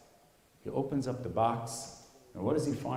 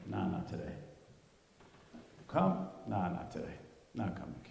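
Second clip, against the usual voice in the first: depressed; 5 semitones lower, 6 dB quieter than usual. Acted. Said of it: "he's depressed".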